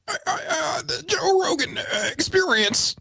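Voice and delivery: very high, soft, stammering; stuttering RFK voice. Retold or imitated. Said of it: "I have to stutter a little more".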